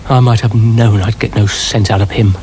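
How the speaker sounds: ordinary delivery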